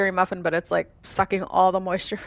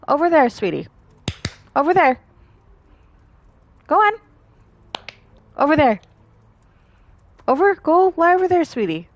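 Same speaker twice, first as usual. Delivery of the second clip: raised, higher pet command; directing the dog; 8 semitones higher, 6 dB louder than usual. Real, not acted. Owner sends dog over there, loud high commands.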